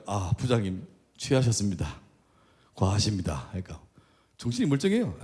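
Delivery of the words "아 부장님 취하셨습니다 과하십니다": low, soft courteous decline; polite refusal. Retold or imitated. Pastor politely refusing a drink from the department head, soft and courteous. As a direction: politely turn him down. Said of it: "내가 정중하게".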